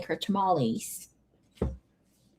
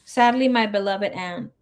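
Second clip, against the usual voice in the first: ordinary delivery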